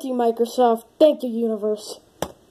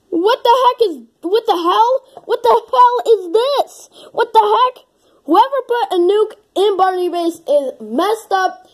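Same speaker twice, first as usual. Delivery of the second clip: high, loud, incredulous outburst; hammed-up panic at what you just read; freaking out; 9 semitones higher, 4 dB louder than usual. Vlogger reacting to a nuke in Barney Base, freaking out.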